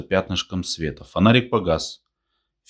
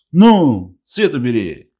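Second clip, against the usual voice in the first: irritated order; 6 semitones higher, 8 dB louder, slower than usual. A narrator's reading. Blinded old man orders light away.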